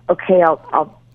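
usual speaking voice

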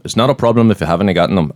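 retry the line in a Scottish accent. Shown as a demonstration. Host shows off his Scottish accent to the co-host, with a light Scottish accent. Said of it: "it's so Scottish".

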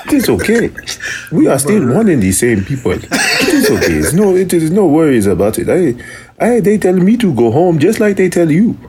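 lilting mock African accent; put-on African accent. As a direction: protest in a hammed-up African accent. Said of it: "because I do not talk like you".

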